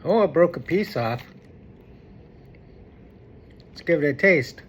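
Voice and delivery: normal talking voice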